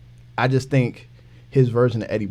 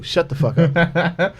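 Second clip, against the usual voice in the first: laughing; 3 semitones higher, much slower than usual. Real, not acted. Exasperated outburst with laugh.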